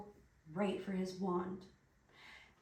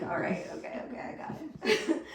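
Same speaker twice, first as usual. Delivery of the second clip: laughing; 4 semitones higher, 5 dB louder than usual. Retold or imitated. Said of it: "You're just like".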